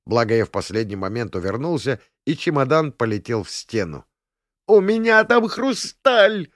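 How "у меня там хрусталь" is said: high, slow tearful wail. A narrator's reading. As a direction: wail it out in panic. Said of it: "завопила барыня-сударыня".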